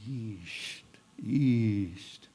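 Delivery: soft breathy paddle sound east east; paddle whirlpool sound. Shown as a demonstration. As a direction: let the word swish like a paddle pulling water. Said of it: "you can hear that sound".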